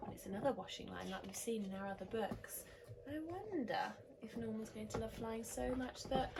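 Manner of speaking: plain reading voice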